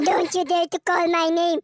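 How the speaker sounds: cold breakup. Acted. [name] ending the engagement, low and icy. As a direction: cut her off for good.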